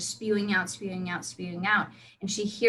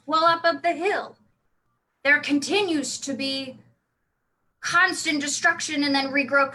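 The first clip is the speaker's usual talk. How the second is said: put-on drunk dwarf; 5 semitones higher, 3 dB louder than usual. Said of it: "you drunk dwar-, dwarf?".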